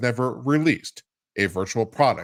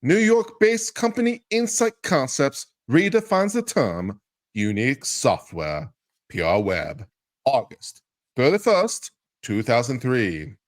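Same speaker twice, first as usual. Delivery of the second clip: higher, pumped-up announcer read of the release; mock press-release hype; 7 semitones higher than usual. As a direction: sell it like a cheesy promo to mock it. Reads hyped press release mockingly.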